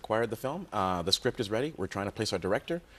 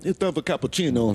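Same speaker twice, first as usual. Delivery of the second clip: sketch voice; 3 semitones higher, 6 dB louder than usual. Acted. Performing noise sketch.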